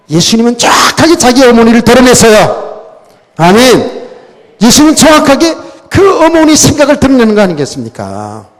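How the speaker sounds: loud, high, emphatic sermon delivery; forceful preaching. Real, not acted. Preacher presses the point for the congregation's amen, forceful preaching. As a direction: drive the point home at full voice.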